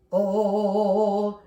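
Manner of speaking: "Oh" with a round dropped jaw, no smile; a dark rounded "Oh"; round oh vowel. Shown as a demonstration. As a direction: drop the palate on "Oh". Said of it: "try that with me".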